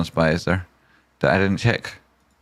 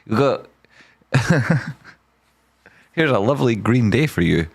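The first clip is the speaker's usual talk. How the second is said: ordinary talk, nothing marked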